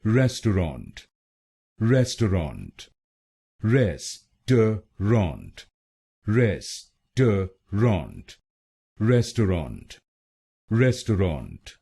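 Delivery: रेस्टोरेंट said as res-tau-rant with stress on res; रेस्टोरेंट repeated as res-tau-rant, first syllable stressed; three-syllable pronunciation. Shown as a demonstration. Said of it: "तो क्या बना?".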